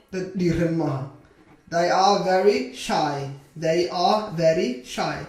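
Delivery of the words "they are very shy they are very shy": slow, evenly spaced words; slow modelling. Shown as a demonstration. Teacher models a sentence for beginners, slowly. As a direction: say it word by word for learners.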